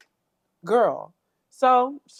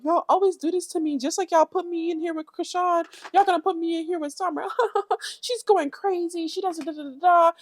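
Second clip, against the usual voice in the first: raised, whiny imitation of her rant; lightly mocking Natalie; 10 semitones higher than usual. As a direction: parody her complaining lightly. Mocking Natalie's trash talk.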